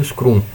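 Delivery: "Scrum" pronounced "scroom", with an oo; Brazilian-style Scrum. Shown as a demonstration. Teacher showing how Brazilians say it, "Scrum" as "scroom". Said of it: "a brasileira".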